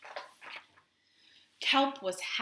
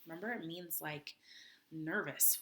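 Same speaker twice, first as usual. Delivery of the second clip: ordinary delivery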